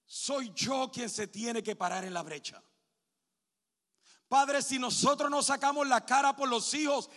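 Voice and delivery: predicando a todo pulmón, más alto y más fuerte; predicación fervorosa. Acted. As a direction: clama al púlpito con fuerza creciente. Pastor preaching to the congregation. (predicación a plena voz)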